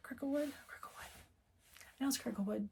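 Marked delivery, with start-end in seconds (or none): 0.1-1.1 whispered to herself. Real, not acted. Trying to recall a shop name.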